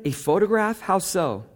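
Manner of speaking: "photograph" lifted high in disbelief, "A photograph?"; surprised question. Retold or imitated. Interviewer questions Charles about the photograph, surprised. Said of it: "I said".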